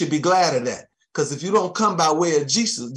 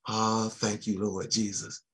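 soft murmured aside to Jesus; murmured prayer; 6 semitones lower, 9 dB quieter than usual. Real, not acted. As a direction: drop to a near-hush prayer under the breath. Murmured prayer aside mid-sermon.

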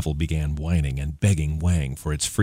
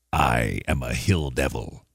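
low, slow, softer monster voice; a sly demon speaking low; hill devil voice; 5 semitones lower, 4 dB quieter, slower than usual. A narrator's reading. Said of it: "said the monster".

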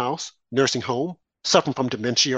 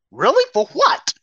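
sharp high incredulous questions; high, loud disbelief; 11 semitones higher, 5 dB louder than usual. Real, not acted. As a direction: scoff in disbelief, pitch jumping up. Incredulous rhetorical outburst.

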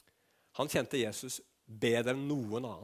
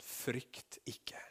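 tender whisper; 2 semitones lower, 11 dB quieter than usual. Acted. Jesus whispers comfort to the fallen Johannes. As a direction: breathe it out like a hand on the shoulder. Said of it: "Så sier Jesus ... som et tegn på kjærlighet".

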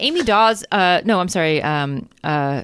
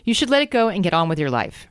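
ordinary talk, nothing marked

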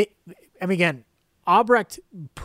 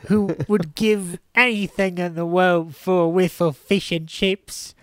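a hammed-up Cockney, a bit off; mock Cockney accent. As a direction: play a hungry Cockney craving fish and chips. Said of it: "I'm sorry if U K listeners were offended by my impression".

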